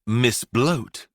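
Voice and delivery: ordinary delivery, nothing marked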